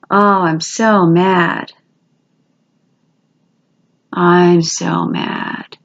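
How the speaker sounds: drawn-out slow so mad; slow mock mad. Shown as a demonstration. Teacher demoing the reminder phrase while tapping, slow mock mad. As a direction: stretch it out slowly, mock anger.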